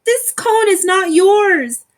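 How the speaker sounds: high, loud, indignant protest; high, loud squirrel squabble. Acted. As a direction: snap back at the rival. Quarrel continues.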